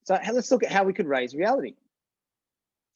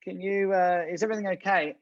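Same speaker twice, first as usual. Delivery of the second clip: tentative intervening; 3 semitones higher than usual. Retold or imitated. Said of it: "silly enough to go".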